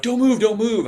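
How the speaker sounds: high, loud mock urgent calls; urgent warning. Teammates to the injured player, retold urgent shouting. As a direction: call out to keep him still. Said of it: "They're like".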